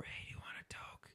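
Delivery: hushed, voiceless re-enacted whisper. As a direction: whisper it over like a furtive offer. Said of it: "whispering over".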